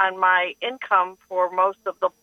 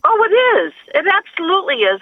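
excited agreement; 7 semitones higher, 6 dB louder, faster than usual. Real, not acted. Caller eagerly agrees with host.

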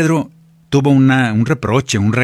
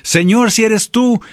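high, drawn-out acted plea; dramatized desperate appeal; urgent plea; 11 semitones higher, 3 dB louder than usual. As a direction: call out slowly and high, begging for rescue. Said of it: "Cualquiera le hubiera dicho".

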